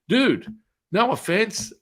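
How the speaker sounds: high, loud exclaim of dude; loud protest. Retold or imitated. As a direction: burst out in protest. Protesting to the mother.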